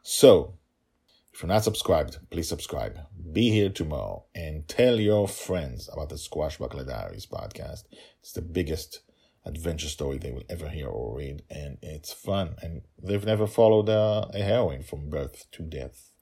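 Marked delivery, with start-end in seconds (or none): none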